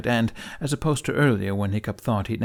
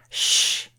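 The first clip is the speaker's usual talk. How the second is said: hissed shush to silence; sharp shush. A narrator's reading. Hiccup shushing his heckling dragon, sharp shush. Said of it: "he had shushed his own dragon".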